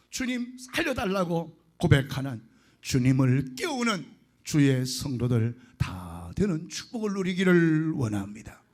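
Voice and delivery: earnest preaching to the flock. Acted. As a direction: exhort the congregation with fervour. Preaches to the congregation. (회중에게 열정적으로 설교)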